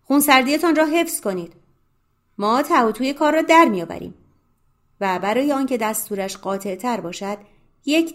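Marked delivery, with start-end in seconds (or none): 0.0-4.1 دستور بلند و بالا با صدای رسا; فریاد آمرانه. A narrator's reading. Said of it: "آمرانه خطاب به افرادش فریاد کشید". (فریادی آمرانه و بلند)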